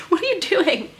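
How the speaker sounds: high pet-talk voice after a laugh; amused pet scolding. Real, not acted. Owner to bunny pulling down cushions, high amused scolding.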